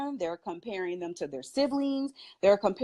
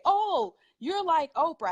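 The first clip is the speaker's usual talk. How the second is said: higher-pitched mock exclamation; imitating gushing people; 6 semitones higher than usual. People used to compare her to Oprah, imitated exclamation. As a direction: quote them in a raised, impressed voice.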